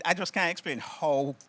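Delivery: normal talking voice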